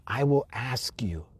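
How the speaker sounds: slow whisper, fading to almost nothing. Acted. Intimate call to remember.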